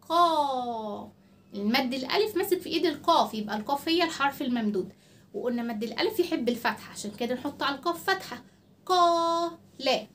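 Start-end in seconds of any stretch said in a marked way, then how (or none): 0.1-1.1 "قا" held long on its vowel; long madd syllable. Shown as a demonstration. Teacher showing which letter carries madd, vowel drawn out. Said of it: "المد ماسك في إيد إيه". 8.8-10.1 "قا" held long and raised, then a short "لا"; long madd syllable. Shown as a demonstration. Teacher showing the madd syllable, first vowel drawn out. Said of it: "وده كده اسمه مقطع المد".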